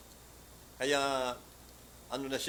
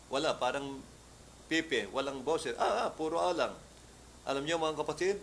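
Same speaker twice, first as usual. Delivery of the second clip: ordinary talk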